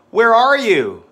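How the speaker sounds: big, high, loud classroom call; loud drill voice. Shown as a demonstration. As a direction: model it loudly for the class. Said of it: "flashcard drill demo".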